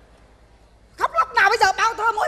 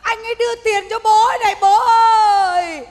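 mock wailing; 5 semitones higher, 11 dB louder than usual. Daughter wailing to her dead father at the funeral, hammed-up. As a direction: wail it out like a hired mourner.

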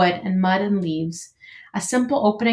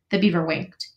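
plain reading voice

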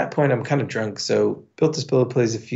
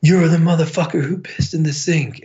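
imitating an irate bouncer, pitched up; angry confrontation; 6 semitones higher, 3 dB louder than usual. Enormous bouncer confronts the sink culprit, angry accusation. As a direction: burst in with a higher-pitched retold accusation.

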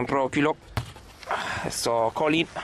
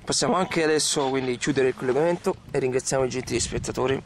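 ordinary delivery

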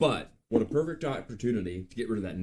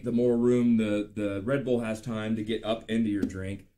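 ordinary talk, nothing marked

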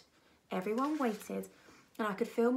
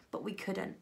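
plain reading voice

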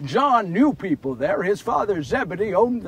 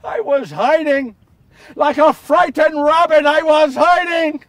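loud, high cry of confession; anguished shame; 6 semitones higher, 8 dB louder than usual. Acted. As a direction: shout out your cowardice in shame. Ashamed confession of cowardice.

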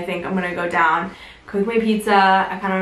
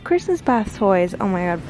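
normal talking voice

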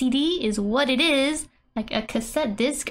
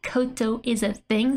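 normal talking voice

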